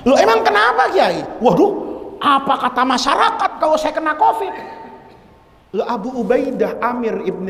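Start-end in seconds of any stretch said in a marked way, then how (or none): 0.1-1.3 high, startled question; high astonished why; surprised. Retold or imitated. Surprised reply on the phone. 1.4-4.5 high half-laughing alarm; comic panic, laughing; fretful laugh. Retold or imitated. Kiai worrying about his reputation.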